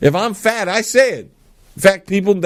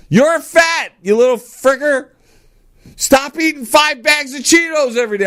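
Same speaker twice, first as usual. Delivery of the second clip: mock scolding; 11 semitones higher than usual. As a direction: yell at the kid over the Cheetos. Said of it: "I'm good with that".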